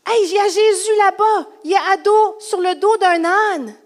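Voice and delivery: high, loud, thrilled announcement; excited sighting. Acted. Follower spotting Jesus over there on a donkey, excited. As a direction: call out the news excitedly.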